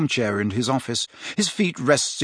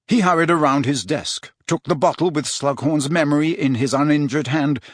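usual speaking voice

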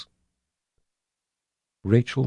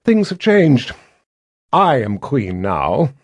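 haughty queen; 4 semitones higher, 4 dB louder, slower than usual. A narrator's reading. Violet declaring herself queen.

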